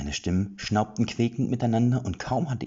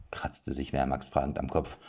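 ordinary delivery, nothing marked

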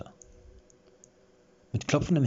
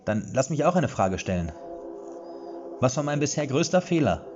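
challenging; 8 semitones higher, 5 dB louder than usual. A narrator's reading. Erik testing her loudly.

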